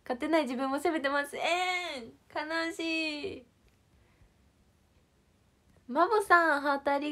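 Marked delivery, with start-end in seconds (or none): 1.3-3.5 very slow, higher fake whimper of えーん; a hammed-up little boo-hoo; mock crying. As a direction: pretend to sob, playfully. Jokes about being sad.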